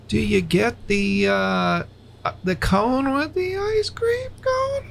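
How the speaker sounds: imitating wife at the drive-thru, voice escalating; escalating order voice. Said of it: "her voice will escalate as she's ordering something".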